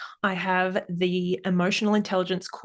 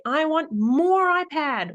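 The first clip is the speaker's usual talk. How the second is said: put-on high, slow whiny little kid; mock child's whine, high and slow; 9 semitones higher, 3 dB louder, slower than usual. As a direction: drag out a childish whine for more. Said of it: "the child might say".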